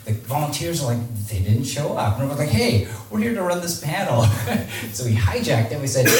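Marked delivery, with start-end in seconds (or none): 2.5-4.3 higher-pitched mock announcement with a laugh; retold announcement. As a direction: announce it to the room, amused. Retold announcing to a packed room.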